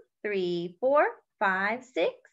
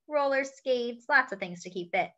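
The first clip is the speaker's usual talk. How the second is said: usual speaking voice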